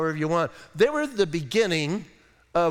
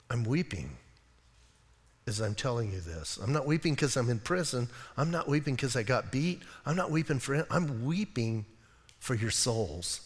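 quiet tearful plea; 4 semitones lower, 6 dB quieter than usual. Acted. Paul weeping for their souls.

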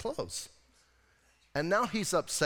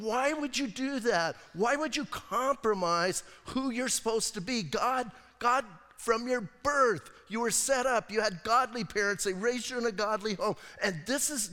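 higher-pitched, disbelieving reproach; exasperated scolding; 5 semitones higher than usual. Acted. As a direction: plead with him, appalled at his choices. Acted scolding of Samson.

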